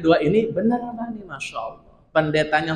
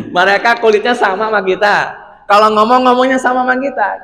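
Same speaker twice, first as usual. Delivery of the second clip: laughing emphasis; 6 semitones higher, 6 dB louder than usual. Acted. Mocking repeat, laughing.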